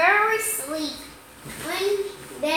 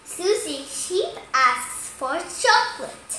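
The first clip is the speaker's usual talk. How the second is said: ordinary delivery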